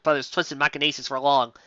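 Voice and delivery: ordinary talk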